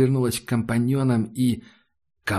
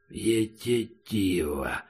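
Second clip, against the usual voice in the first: low, slow, much softer creature voice; monster grumble; 4 semitones lower, 7 dB quieter, much slower than usual. Acted. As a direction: mangle it like a beast. Monster mimics the word.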